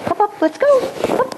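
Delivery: high, springy call to the puppy; eager call. Shown as a demonstration. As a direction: bounce the words up to get her moving. Calling puppy to follow.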